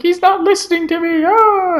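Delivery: very high frantic wail ending in a cry; desperate Jody. Retold or imitated. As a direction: play Jody losing control. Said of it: "She's like".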